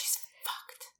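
answering in a whisper; breathy unvoiced whisper. Joins the whisper bit.

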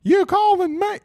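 put-on high, loud little-kid twang; high loud child's twang; kid's twangy voice. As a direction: mimic a young son calling out. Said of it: "twang to him".

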